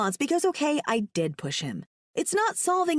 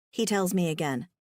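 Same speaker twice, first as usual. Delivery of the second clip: plain reading voice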